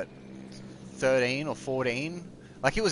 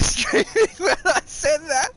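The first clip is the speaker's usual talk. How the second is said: high, loud words through real laughter; laughing; 6 dB louder than usual. Real, not acted. Player realising he was live streaming, laughing hard. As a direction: crack up laughing as you say it. Laughing at his own slip.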